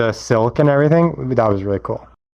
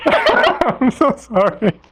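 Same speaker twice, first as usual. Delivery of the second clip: high, slow, giggling sorry; laughing apology; 12 semitones higher, much slower than usual. Real, not acted.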